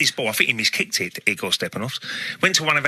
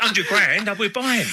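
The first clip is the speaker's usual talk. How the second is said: normal talking voice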